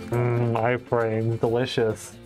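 ordinary talk, nothing marked

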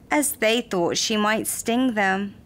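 usual speaking voice

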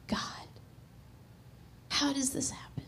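hushed, breathy prayer to God; awed whisper. Real, not acted. Mother marveling to God at the church in a hushed whisper. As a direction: speak it like a private marvel.

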